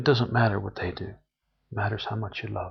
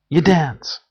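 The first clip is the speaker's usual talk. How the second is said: high, loud burst of joy; joyful exclaim; 6 semitones higher, 10 dB louder than usual. Real, not acted. Declaring that hearing the music makes you dance, joyfully and loud. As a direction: let it leap out.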